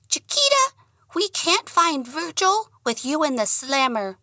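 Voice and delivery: high squeaky little dog voice; a tiny dog talking sense. Acted. The dog reasoning with his owner, high squeaky voice.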